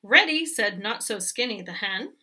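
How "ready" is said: calling ready. Acted. Hen calls back.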